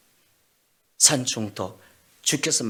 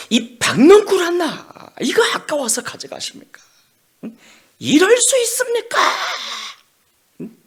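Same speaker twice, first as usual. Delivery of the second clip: much higher, louder screaming complaint at God; furious shouting; 10 dB louder than usual. Acted. Jonah screaming at God over the lost vine. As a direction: scream it at heaven. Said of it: "분노하며 악을 씁니다".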